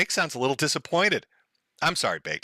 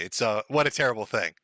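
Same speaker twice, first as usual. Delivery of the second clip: usual speaking voice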